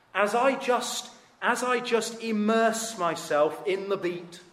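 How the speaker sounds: high, loud and drawn-out immersion; mock rapture. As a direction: play a worshipper swept away by the beat. Mimics worshipper swept up in music.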